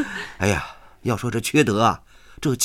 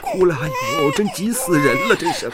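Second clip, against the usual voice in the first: put-on higher, exasperated pleading with a baby; at wits' end; 7 semitones higher than usual. Police officer failing to soothe a wailing foundling, frantic. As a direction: plead helplessly, frayed by the crying.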